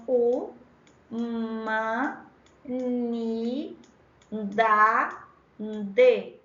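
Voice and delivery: humanidade split into slow, drawn-out pieces "U, ma, ni, da, de"; sound by sound. Shown as a demonstration. Teacher counting phonemes for students, "U, ma, ni, da, de" sounded out separately. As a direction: say "U, ma, ni, da, de" separately, holding each sound. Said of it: "Vamos contar?".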